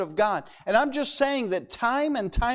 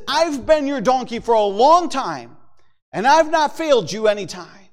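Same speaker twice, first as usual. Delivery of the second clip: louder, higher-pitched donkey voice; acted-out animal protest, raised in pitch and volume; talking donkey; 4 semitones higher, 5 dB louder than usual. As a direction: plead loudly as a long-serving donkey. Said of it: "the donkey opens his mouth".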